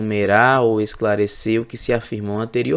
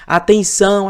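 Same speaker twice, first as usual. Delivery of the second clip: high, loud shout of Atenção; strong call for attention; 9 semitones higher, 6 dB louder than usual. Shown as a demonstration. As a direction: call out Atenção to stop a crowd. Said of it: "aquele pedido de atenção forte".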